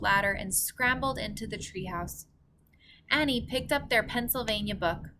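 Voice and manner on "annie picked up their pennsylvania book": ordinary delivery, nothing marked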